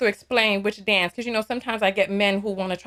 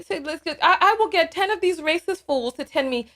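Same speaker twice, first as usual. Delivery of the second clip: usual speaking voice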